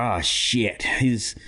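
ordinary delivery

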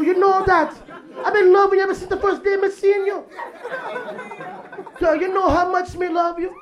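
high, pleading put-on Jamaican accent. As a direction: beg her not to leave like a Jamaican boyfriend. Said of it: "A Jamaican guy will break up".

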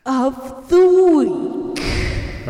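big, slow, loud announcer call; game show host. Shown as a demonstration. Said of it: "like a game show host".

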